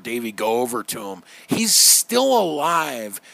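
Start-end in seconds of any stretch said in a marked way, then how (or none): none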